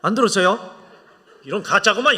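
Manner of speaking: loud playful jab at the congregation; teasing shout. Real, not acted. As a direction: call them out with a big laugh.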